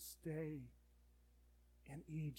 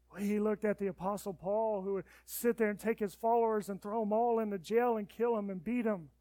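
loud, high impassioned preaching; fiery pulpit delivery; preaching at full force; 5 semitones higher, 13 dB louder than usual. Acted. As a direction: preach it out to the congregation.

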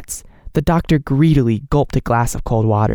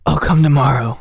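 plain reading voice

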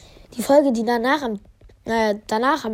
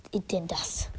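whispering; 5 semitones lower, 13 dB quieter than usual. Real, not acted. Whispers at a bad pull.

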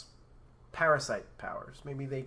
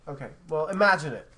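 ordinary talk

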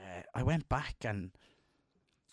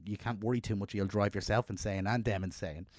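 normal talking voice

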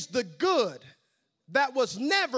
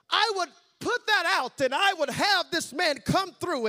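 eager Haman; 9 semitones higher, 7 dB louder than usual. Acted. Haman eagerly instructs the king.

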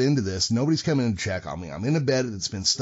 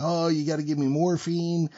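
high, sustained mock moan; whining addict impression; 7 semitones higher than usual. Patient begging the doctor for drugs, mock whining moan. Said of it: "The guy's like".

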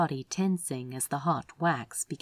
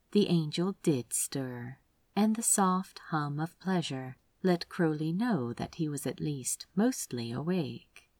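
plain reading voice

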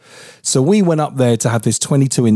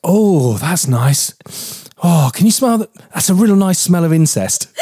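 higher, put-on sniffing voice; imitating partner; 6 semitones higher than usual. Teasing her partner by replaying her slip. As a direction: mimic her sniffing the air, amused. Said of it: "and you went".

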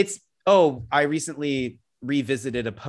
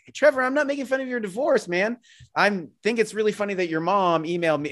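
voicing Scott; 8 semitones higher than usual. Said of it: "And be like".

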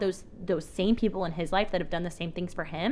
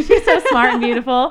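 loud, laughing, swooning praise; laughing gush; 4 semitones higher, 12 dB louder than usual. Pretend. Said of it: "He's like".

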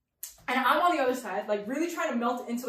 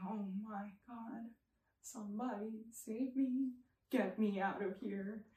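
very soft, acted mortified mumble; mumbling under breath; 2 semitones lower, 13 dB quieter than usual. Embarrassed daughter trying to escape the taxi talk, mumbled under her breath. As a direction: plead to yourself, barely audible. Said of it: "mumbling curse words under".

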